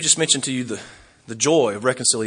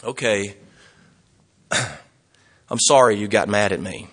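mock insincere apology; 5 semitones lower, slower than usual. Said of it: "you don't go and say".

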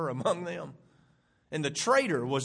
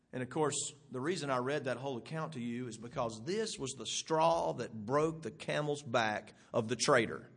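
ordinary talk

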